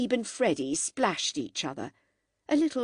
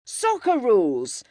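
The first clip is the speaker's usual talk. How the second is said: louder, higher playful retort; 4 semitones higher, 6 dB louder than usual. A narrator's reading. George retorts to Freddy mid-game, loud playful shout. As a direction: fire it back in the game. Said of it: "George retorted".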